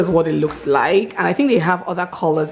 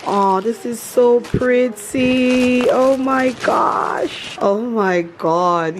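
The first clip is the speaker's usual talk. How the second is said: slow, breathy awe; awed admiration; 3 semitones higher, much slower than usual. Real, not acted. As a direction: savour each word in wonder. Admiring pretty outfit, slow.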